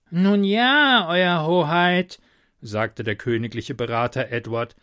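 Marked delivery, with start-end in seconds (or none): 0.1-2.2 hesitant, slow adviser voice; cautious royal adviser. A narrator's reading. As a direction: tread carefully with the king.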